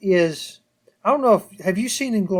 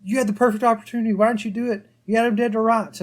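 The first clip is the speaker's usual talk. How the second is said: fast, higher frustrated plea at the screen; exasperated viewer; 6 semitones higher, faster than usual. Retold or imitated. Viewer yelling at the characters in frustration. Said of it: "as the watcher watching this episode".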